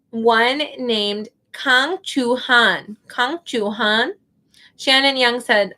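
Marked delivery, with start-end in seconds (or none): 0.1-4.1 "Kang Chu Han" drawn out slowly; slow, careful name reading. Shown as a demonstration. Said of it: "says her name so fast".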